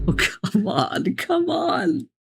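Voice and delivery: slow, high-pitched whine of dismay; exasperated plea. Real, not acted. Player pleading with a hard map game, exasperated.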